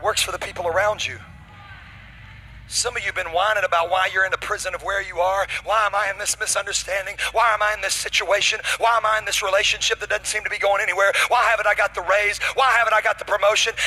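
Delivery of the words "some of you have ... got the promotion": loud preacher building higher and louder; fired-up sermon delivery; full-force preaching. Real, not acted. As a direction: drive it relentlessly up to a shouted climax.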